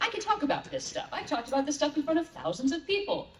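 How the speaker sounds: high-pitched, self-convincing insistence; imitated self-assurance. Guest retells a pep talk before recording, brightly over-confident.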